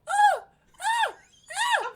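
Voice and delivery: very high squealed fake moans; hammed-up falsetto pig-diva cries, building to a sneeze; Miss Piggy. Retelling the diner parody for a co-host, squealed Miss Piggy impression. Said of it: "in the Miss Piggy voice".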